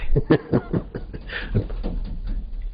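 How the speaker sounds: soft, breathy chuckle of a god; storyteller's chuckle. Acted. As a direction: let a hushed laugh spill out. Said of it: "हंसी छलकाई". (छलकती दबी हँसी)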